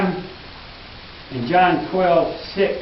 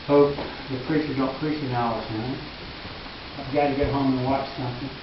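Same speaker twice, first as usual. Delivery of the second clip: muttered low and quick, mock-bored; a fidgety congregant hoping the sermon ends soon, mock grumble; bored churchgoer aside; 5 semitones lower, 8 dB quieter, faster than usual. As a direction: grumble it fast under your breath like an impatient pew-sitter.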